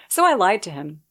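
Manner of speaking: normal talking voice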